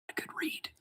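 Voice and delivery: breathy whispered aside. Acted. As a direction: whisper it under your breath as the character. Character aside, mumbled in-story.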